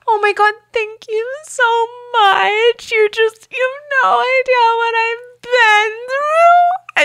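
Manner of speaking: high, slow, wailing put-on sob; mock crying guest. Mimicking the hotel guest who bursts into tears, high wail. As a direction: ham up the guest's tearful gratitude. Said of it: "And then she starts crying".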